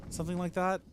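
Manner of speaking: ordinary talk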